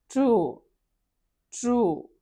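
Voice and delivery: "ట్రూ" said like T-R-U; pronounced as T-R-U. Shown as a demonstration. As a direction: model "ట్రూ" with a T-R start and U vowel. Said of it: "టీ ఆర్ యూ". ("ట్రూ" టీ ఆర్ యూగా పలకడం)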